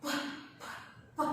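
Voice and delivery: प puffed out very softly, only air; breathy p puffs. Shown as a demonstration. Teacher puffing p for kids to feel the air. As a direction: let each प be a soft puff onto the finger. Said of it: "जो आपकी air है उसको finger पे touch करनी पड़ेगी".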